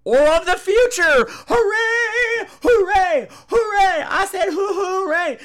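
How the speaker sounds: high, loud, drawn-out hooray; high shouting hooray with a laugh; mock coronation cheer. Real, not acted. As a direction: crown him with campy cheering. Crowning a Scream Queen.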